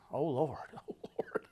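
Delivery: breathy whispered plea under his breath; whispering Oh Lord in fear; whispered prayer. Retold or imitated. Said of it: "So I was like".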